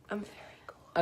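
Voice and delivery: playful whisper. Real, not acted. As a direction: whisper it back, teasing. Joking reply to a compliment.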